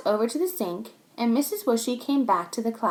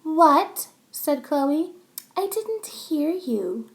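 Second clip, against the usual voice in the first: higher teasing demand; taunting; 6 semitones higher, much slower than usual. Acted. Chloe teases Max to repeat, higher. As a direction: make him say it again.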